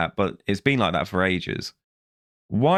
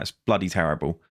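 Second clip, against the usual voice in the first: ordinary talk, nothing marked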